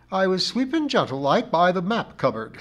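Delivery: high, loud, dignified char lady; injured dignity. A narrator's reading. Missus Pringle explains her accident by the map cupboard, with dignity. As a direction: tell your grievance with great dignity. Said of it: "said Missus Pringle with dignity".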